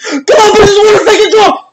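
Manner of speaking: high, fast cry of disbelief; shocked outburst. Real, not acted. Fan hearing the draw result, shocked outburst. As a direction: shout it in shrieking disbelief.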